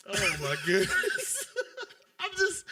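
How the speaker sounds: slow, laughing oh my goodness; can't stop laughing; laughing hard over the Shaq quote. Real, not acted.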